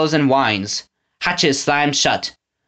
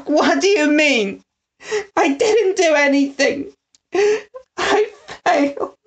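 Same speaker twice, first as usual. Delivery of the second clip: put-on high, tearful boy's voice; sobbing protest. A narrator's reading. Eggs defends himself to the prisoner, sobbing. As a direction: plead through tears. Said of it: "Eggs sobbed".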